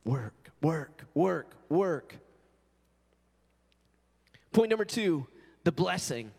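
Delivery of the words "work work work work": low, plodding "work, work" chant; mock busy drone. Preacher mimicking himself ignoring Jesus, low droning. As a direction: grind the words out like a machine. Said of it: "I'm just like".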